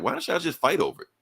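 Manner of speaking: mock heckler yelling a suggestion; yelled out from the crowd. Bystander calls out to Tony and Felon, a mild mock yell. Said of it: "somebody just yells out".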